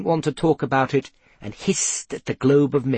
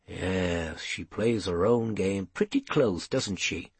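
low, hushed, measured reply; unruffled Pewter; 6 semitones lower, 7 dB quieter, slower than usual. A narrator's reading. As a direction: answer an outburst softly, in a deep register. Pewter answers Seth's outburst calmly.

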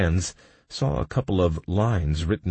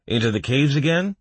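louder, high rising question; brash, raised-voice query; barked; 10 semitones higher, 5 dB louder, faster than usual. A narrator's reading. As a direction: bark the question out. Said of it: "barked Baum the ass".

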